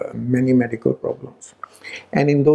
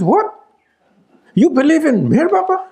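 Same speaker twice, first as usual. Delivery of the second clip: high, louder incredulous question, retold; shocked disbelief; 13 semitones higher, 4 dB louder than usual. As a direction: voice her shock, pitched way up. Mother discovering fiancé's friend's faith.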